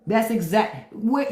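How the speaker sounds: ordinary talk, nothing marked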